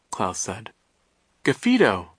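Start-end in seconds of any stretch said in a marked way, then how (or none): 1.4-2.1 small shriek, pitched a bit higher; understated little squeal; mild shriek. A narrator's reading. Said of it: "Sunny shrieked".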